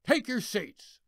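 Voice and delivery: high-pitched barked order; mock sergeant yell. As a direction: snap the order like an embarrassed sergeant. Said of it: "McGovern turned his happy shade of red and yelled".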